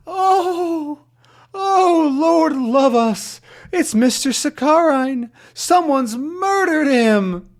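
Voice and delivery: high, loud, slow horrified woman's voice; a horrified lady crying out about the murder; frightened lady. Acted. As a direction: wail it out like a shocked landlady.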